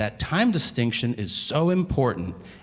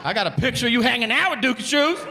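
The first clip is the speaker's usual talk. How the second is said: detective pressing; 10 semitones higher than usual. Acted.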